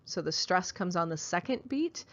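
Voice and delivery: ordinary talk, nothing marked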